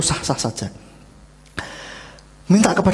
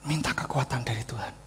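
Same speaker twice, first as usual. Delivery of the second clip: soft, whispery plea; hushed repeat; 3 semitones lower, 9 dB quieter than usual. Real, not acted. Preacher repeating the key point to the congregation, hushed. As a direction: drop to an intimate whisper.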